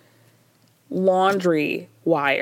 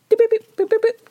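high-pitched phone key beeps; imitated texting beeps; 14 semitones higher, 4 dB louder than usual. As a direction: make little high beeps for busy texting.

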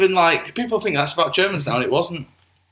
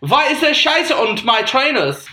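higher-pitched, mock-angry German; angry German accent; 6 semitones higher, 3 dB louder than usual. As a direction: complain as a cross German man. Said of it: "You need to go more angry with it".

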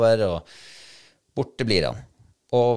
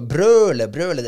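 loud, high-pitched re-enacted roar; retold bellow; 7 semitones higher, 9 dB louder than usual. Hunter retelling how he had to roar at his dog, raised bellow. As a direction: bellow it as if calling a distant dog.

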